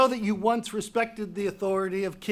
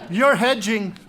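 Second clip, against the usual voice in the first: louder, cutting accusation; sharp accusation; 7 dB louder than usual. Acted. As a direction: snap it at him accusingly. King confronts guard.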